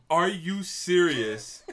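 loud, slow, drawn-out "are you serious"; super loud. Retold or imitated. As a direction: yell it out slow and loud in disbelief. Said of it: "Super loud".

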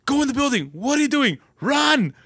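high, loud gamer yelling; frantic shouting at teammates. Retold or imitated. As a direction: bark orders at a teammate across the map. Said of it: "'cause I'm over here yelling".

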